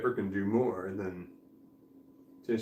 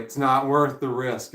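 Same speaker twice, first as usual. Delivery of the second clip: louder, slower It's not worth the risk; mock lawyer warning; 2 semitones higher, 7 dB louder than usual. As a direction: lay the warning down firmly and slow. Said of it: "The lawyer's just like".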